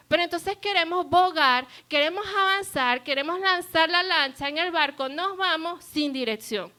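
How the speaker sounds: fervent rising sermon; building preaching. Real, not acted. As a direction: push it out to the congregation.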